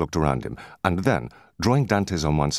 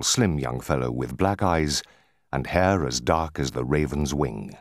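ordinary delivery

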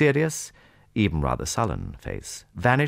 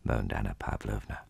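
plain reading voice

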